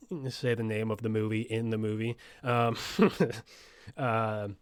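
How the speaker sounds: ordinary delivery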